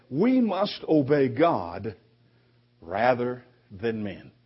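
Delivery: slow, weighty proclamation; solemn declaration. Retold or imitated. Preacher slowly proclaims the apostles' answer to the council. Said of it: "answered and said".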